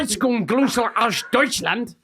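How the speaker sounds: slow, high, confident German: Herzlich Grüße aus Deutschland; Grüße stretched out in a hammed German accent. Shown as a demonstration. S1 shows S0 how to say the German greeting, in a German accent. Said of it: "let me do this".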